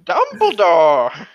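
loud, drawn-out exclamation; drawn-out call. Acted. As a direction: stretch the name out in a big shout. Calls out a name in reading.

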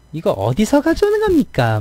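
very high, stiff Busan-tinged Seoul imitation; a Busan native straining to sound Seoul; Busan person faking Seoul speech. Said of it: "뭐 이런 거". (서울말 흉내 내는 높은 톤의 부산 억양)

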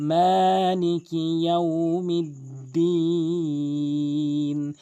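very slow, chanted মালিকি ইয়াউমিদ্দিন with long মা; stretched recitation. Shown as a demonstration. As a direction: hold মা and দিন in মালিকি ইয়াউমিদ্দিন. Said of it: "মিম এক আলিফ টানবেন".

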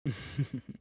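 a short, pleased, mocking laugh; amused villain chuckle. Captor laughs at his frightened bride with a pleased chuckle.

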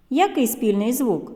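ordinary talk, nothing marked

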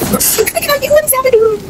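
very high mocking squeal. Gamer squealing a taunt at an enemy pair.

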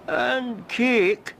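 milk it like a greedy invalid; groaning. Acted. Cromarty groaning for cake from his sickbed. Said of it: "groaned Cromarty".

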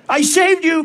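high-pitched, acted outcry; mock-dramatic protest, pitched well up; crying out. A narrator's reading. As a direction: cry out as the betrayed woman in the poem. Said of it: "cried the woman".

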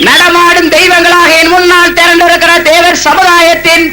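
rising, ringing rally address building to shouts; thunderous political oratory. Real, not acted. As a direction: hail the crowd like a stage orator. Rousing greeting to the crowd. (முழங்கும் அரசியல் மேடைப் பேச்சு)

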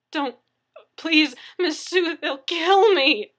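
high, loud, drawn-out wail of fear; desperate begging. A narrator's reading. Victim begging neighbor not to call, desperate. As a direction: wail the plea slowly in terror.

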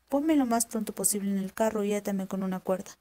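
ordinary delivery

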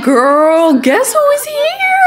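high, loud squealing shout; excited fangirl shout. Retold or imitated. Avatar shouting to friend about [name], squealing loud. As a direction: scream it to a friend in delight. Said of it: "She like".

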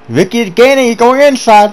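high, urgent alarm as rival closes; sudden rush of race tension; excited. Real, not acted. Rival closing in during race.

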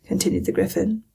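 plain reading voice

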